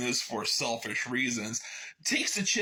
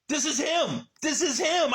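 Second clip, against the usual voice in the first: high, eager pointing out; excited; 9 semitones higher, 3 dB louder than usual. Real, not acted. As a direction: show it off excitedly. Showing the robot picture excitedly.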